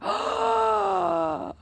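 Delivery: long, high gasp; shocked gasp. Real, not acted. Streamer suddenly realizing a puzzle mistake, long gasp. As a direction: gasp sharply and hold it, startled.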